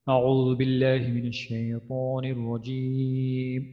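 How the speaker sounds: slow melodic chanted recitation; Quranic recitation. Shown as a demonstration. Teacher opening the surah lesson, slow chanted recitation. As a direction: intone it slowly like tilawat.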